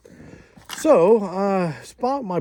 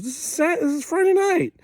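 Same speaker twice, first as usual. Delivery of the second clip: very high-pitched, loud disbelief; acted incredulity; 5 dB louder than usual. Hospital worker learns the crash was Sunday, acted incredulity. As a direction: imitate someone astonished by the delay. Acts out staff's disbelief at delay.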